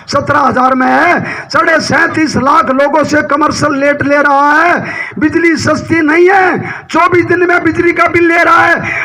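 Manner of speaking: full-voiced political speech, phrase by phrase; forceful stump-speech delivery; rally oratory. Real, not acted. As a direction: address a big crowd in emphatic, sustained bursts.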